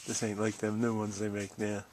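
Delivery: normal talking voice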